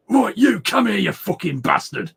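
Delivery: loud, high northern English outburst; northern English swearing. Acted. Showing how they swear when angry, northern English accent. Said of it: "I go so northern".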